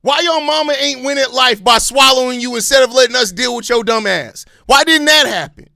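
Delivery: heated scornful outburst; angry insult. Real, not acted. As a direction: berate them, utterly fed up. Insulting the complaining fans.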